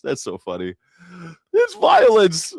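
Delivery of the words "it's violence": shrill mock outrage.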